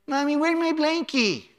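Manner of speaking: mock a grown kid whining like a baby; grown teen as toddler.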